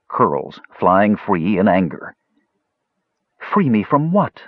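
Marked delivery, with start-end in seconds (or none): 3.4-4.4 demanding. A narrator's reading. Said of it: "she demanded".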